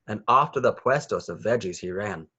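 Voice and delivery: plain reading voice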